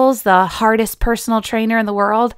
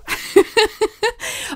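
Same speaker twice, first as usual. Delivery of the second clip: high breathy giggle; giddy high laugh; 11 semitones higher than usual. Retold or imitated. Mock festival fan giggling about Bonnaroo. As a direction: giggle like the starry-eyed fan. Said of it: "I hear this a lot".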